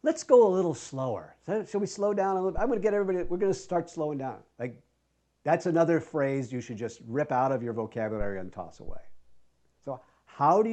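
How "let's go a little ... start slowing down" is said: overly polite, rushed request; the wrong cue. Shown as a demonstration. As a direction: coax the class too nicely. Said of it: "the worst thing you can do is say".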